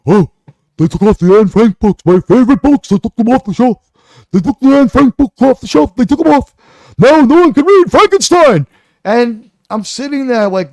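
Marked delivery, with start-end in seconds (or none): 0.1-8.7 mock lament. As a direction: wail over lost books like a fool. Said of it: "he said".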